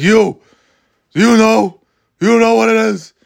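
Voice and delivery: put-on loud, high old New York teacher; Ken Jacobs impression. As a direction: pounce on a student with a barked challenge. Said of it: "that's what Ken Jacobs sounded like".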